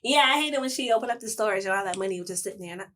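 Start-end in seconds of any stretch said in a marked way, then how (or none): none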